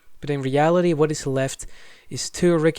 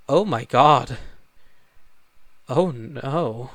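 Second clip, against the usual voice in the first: mock dismay; 2 semitones lower, much slower than usual. Real, not acted. Reacting to speaker now in fifties.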